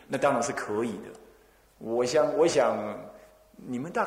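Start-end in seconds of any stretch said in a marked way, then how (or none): none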